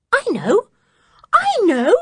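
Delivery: high, rising eager cry; sudden excitement. Acted. George to the others, bursting with excitement. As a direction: burst out with the answer. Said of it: "said George suddenly".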